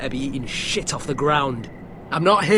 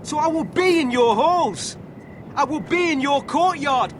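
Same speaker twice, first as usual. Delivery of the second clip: raised pitch, each demand hammered home; domineering assertion; 8 semitones higher than usual. Acted. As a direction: lay down the law. Intruder asserts power over household.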